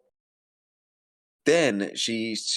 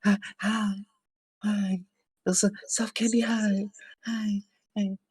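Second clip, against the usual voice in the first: tiny high-pitched hellos; mock sweet greeting; 12 semitones higher than usual. Her greeting people who confront her, mockingly high. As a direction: imitate her in a little squeaky voice. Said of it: "she goes".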